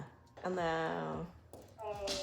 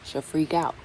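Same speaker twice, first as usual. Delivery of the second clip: usual speaking voice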